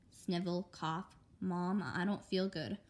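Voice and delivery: ordinary delivery, nothing marked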